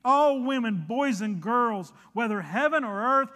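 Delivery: loud, rising preacher's proclamation; full-force preaching. Acted. Preacher proclaiming to the congregation, loud and soaring. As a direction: preach it out to the whole room, building.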